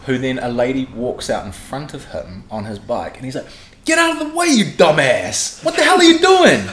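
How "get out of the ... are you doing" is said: high-pitched, loud, outraged shout; angry mock yell. Storyteller imitating expat Kiwi yelling at a lady, loud outrage. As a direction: yell it like a furious cyclist, hammed up.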